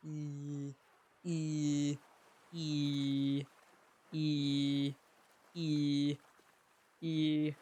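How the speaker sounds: low, sustained steady vowel notes. Shown as a demonstration. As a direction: hold each vowel low and even. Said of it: "Now I have to retrain it".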